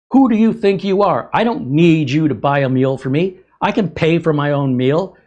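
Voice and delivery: high, loud mock outrage from the lady; indignant yell at the stranger who paid; retold angry shout. Angry lady yelling at the man who paid for her meal, loud and high.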